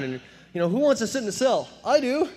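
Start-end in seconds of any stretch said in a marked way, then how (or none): 0.7-1.7 inviting inmate. Retold or imitated. Inmates offer a cell. 1.8-2.3 high, loud eager reply; eager volunteer. Retold or imitated. Cocky teen eagerly volunteers for the cell, high and loud.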